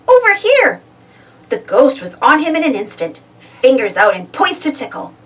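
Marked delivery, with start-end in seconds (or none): 0.1-0.8 high, louder call to get attention; shout. A narrator's reading. Pablo luring the ghost away, shouting. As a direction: shout to distract her. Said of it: "he shouted".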